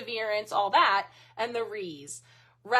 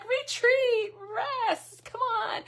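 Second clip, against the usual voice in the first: mock exasperated; 13 semitones higher, slower than usual. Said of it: "I'm like".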